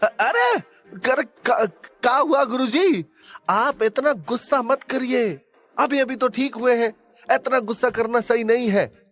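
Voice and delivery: high, stammering Bihari sidekick; panicky. Acted. Frightened sidekick calming his angry guruji, panicky. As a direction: stammer in alarm, then plead high-pitched. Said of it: "छैला बिहारी घबरा गया".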